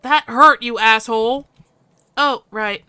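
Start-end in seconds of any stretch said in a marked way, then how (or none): 0.0-1.5 angry yell. Acted. To Bite who hurt him, a loud angry yell. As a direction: throw the insult at him.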